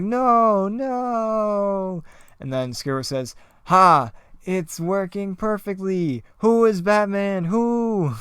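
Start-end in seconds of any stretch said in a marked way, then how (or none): 0.0-2.0 high, loud hammed-up cry of "no"; playful falling-scream; mock scream. Robin falls from the cut tightrope, high mock cry. 3.6-8.1 high, loud cackling mad-scientist gloat; hammed-up villain, shrieking "Who?"; gleeful villain. Scarecrow gloats over hallucinating Robin, high and hammed-up.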